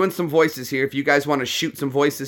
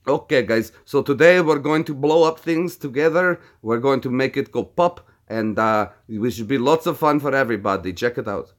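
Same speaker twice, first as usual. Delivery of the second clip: put-on upbeat, thick Russian accent; crazy Russian hacker. Streamer mimics a hacker hosting an experiment, Russian accent. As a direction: play an eager Russian-accented video host. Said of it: "crazy Russian hacker voice".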